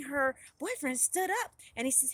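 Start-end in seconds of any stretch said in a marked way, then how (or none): none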